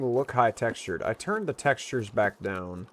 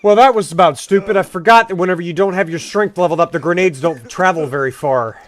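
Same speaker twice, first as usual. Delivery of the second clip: loud; 5 semitones higher, 10 dB louder than usual. Real, not acted. Shaken after a grenade blows.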